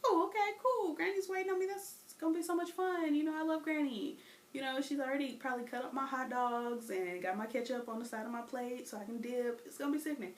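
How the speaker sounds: put-on a child happily picturing granny's hot dogs; giddy girl talking about Granny; excited kid. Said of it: "So I'm like".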